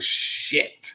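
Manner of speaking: breathy whispered shit under the breath; whispering a stunned curse to himself. Retold or imitated. Recalling the tense standoff, a whispered curse.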